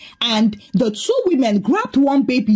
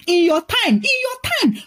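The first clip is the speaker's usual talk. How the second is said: mocking shout; 6 semitones higher than usual. A narrator's reading. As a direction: throw it back, higher on the repeat. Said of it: "Liz shouted".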